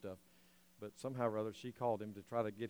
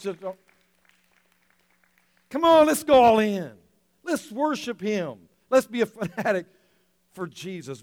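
loud, high, fervent preaching; rally the congregation to go all in; preaching at full force; 8 semitones higher, 14 dB louder than usual. Acted. Preaching climax on passion.

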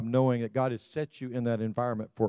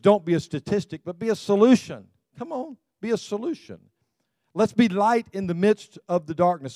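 rising exhortation; 8 semitones higher, 6 dB louder than usual. Real, not acted. Pastor exhorting congregation.

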